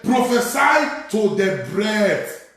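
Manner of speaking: high, urgent pulpit exhortation; full-force preaching. Real, not acted.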